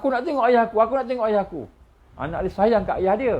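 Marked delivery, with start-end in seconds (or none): 0.0-1.6 high, loud desperate pleading; pleading cry. Acted. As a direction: beg them to let you see your father. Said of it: "Akhirnya dia kata".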